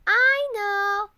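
loud, high "I know"; stretched-out impatient retort; crying out. A narrator's reading. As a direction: cry it out like an eager kid. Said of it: "cried Froggy".

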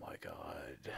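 real whispered disbelief; appalled whisper. Real, not acted. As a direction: breathe it out in horror. Reader appalled by the story.

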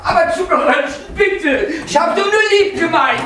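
pleading. Acted. Husband begging his wife not to serve salad, whiny and high. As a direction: beg her to take it back.

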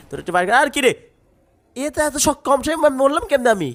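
can't believe you died; shocked disbelief. Real, not acted. (হঠাৎ মরে গিয়ে উঁচু গলায় অবিশ্বাস)

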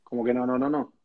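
ordinary delivery

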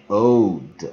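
put-on "old" said slowly and firmly; "old" drawn out as a clear model; model word old. Shown as a demonstration. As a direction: lean on "old" with a long, gliding o. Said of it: "你会念old".

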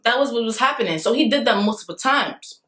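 usual speaking voice

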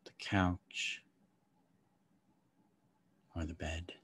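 calm guide easing them inward; guided meditation. Acted.

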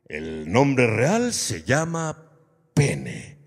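low, slow, weighty pronouncement; solemn lecturer. Acted. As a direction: reveal the term with great solemnity. Lecturer solemnly answers the child.

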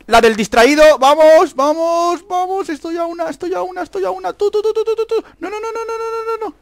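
loud start into high, tight repetitions; nervous high-pitched chanting; frantic anticipation. Real, not acted. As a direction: pitch climbing to a squeal, volume falling back. One kill from winning.

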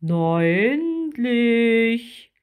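loud, very drawn-out giant voice; big creature's long, rising-and-falling exclamation; cave creature. A narrator's reading. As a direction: stretch it out hugely, with impatience. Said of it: "sagte der Bewohner der Höhle".

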